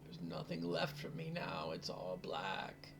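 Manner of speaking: put-on high, wailing old crone lamenting her lost daughter; keen it out high, like a broken old mother; grieving old woman. Old mother to the heroes, high grieving wail.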